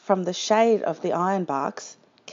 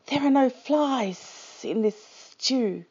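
hissing lizard; 4 semitones higher than usual. A narrator's reading. Lizard objecting to Dingo's stew, hissing. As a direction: stretch every s like a lizard. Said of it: "he hissed".

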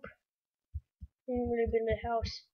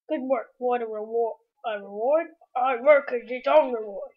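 game NPC voice; 7 dB louder than usual. Acted. Reading NPC reward text.

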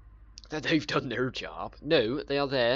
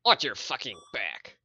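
louder, lightly done Italian American tough-guy threat; a hint of an old-school gangster warning; 4 dB louder than usual. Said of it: "That was my Italian American".

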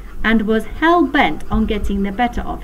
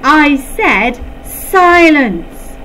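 louder, much higher and very slow; slow furious shout; 7 semitones higher, 8 dB louder, much slower than usual. A narrator's reading. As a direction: drag out every word in rage. Teacher repeats order, losing control.

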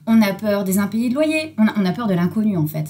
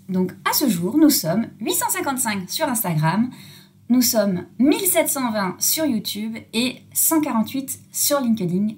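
ordinary delivery

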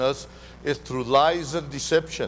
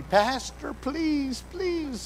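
put-on high, pleading child voice; a child pleading with the pastor, high and soft; little girl begging; 11 semitones higher, 3 dB quieter, much slower than usual. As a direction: beg like a small girl. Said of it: "she kept telling me".

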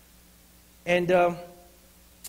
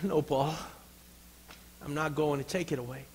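lower, gentle answer; calm divine refusal; 3 semitones lower, 6 dB quieter than usual. Acted. God answering Paul's third plea, calm refusal. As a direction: answer softly and without force. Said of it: "The answer?".

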